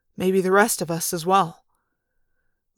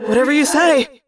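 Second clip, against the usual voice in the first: raised, higher-pitched reply; calling back; 5 semitones higher, 5 dB louder than usual. A narrator's reading. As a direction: call back your agreement under fire. Tobias answers the yell.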